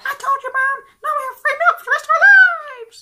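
put-on very high, loud, fast laughing kid; a kid crowing to mom; gloating child.

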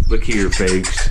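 normal talking voice

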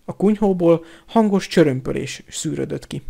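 plain reading voice

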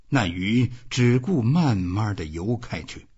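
usual speaking voice

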